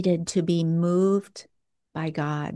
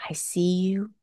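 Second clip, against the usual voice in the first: whispered voice of God; still small voice. Acted. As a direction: whisper it as a divine reassurance. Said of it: "The still small voice".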